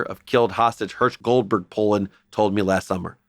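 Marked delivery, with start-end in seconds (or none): none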